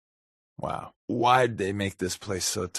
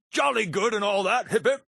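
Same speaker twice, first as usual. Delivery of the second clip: mock British toff; 11 semitones higher than usual.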